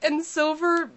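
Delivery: rising into laughing disbelief; can't believe her, laugh at the end; incredulous. Retold or imitated. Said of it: "I'm like".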